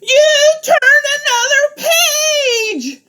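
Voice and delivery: very high, slow sung wail; dismayed Grover. Acted. Grover crying out that the page turned. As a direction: wail it in horror.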